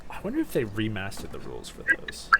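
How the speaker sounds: whispered aside to self; soft muttered whisper. Real, not acted. GM muttering aside while checking rules.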